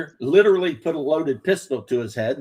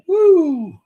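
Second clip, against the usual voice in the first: mock party whoop; 8 dB louder than usual. Wild party cheer.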